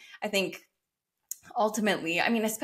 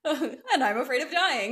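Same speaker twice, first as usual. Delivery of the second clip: laughing through a mock confession, pitched up; laughing realization; 6 semitones higher, 3 dB louder than usual. Podcast host laughing while voicing a fearful realization. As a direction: giggle it out as a realization. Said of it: "you're like".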